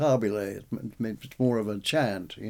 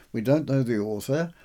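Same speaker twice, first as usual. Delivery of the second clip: usual speaking voice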